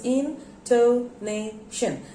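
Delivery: INTONATION slowly in four separate beats; syllable by syllable. Shown as a demonstration. Teacher showing four syllables, spaced out. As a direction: count out each syllable of INTONATION. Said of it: "there are four syllables".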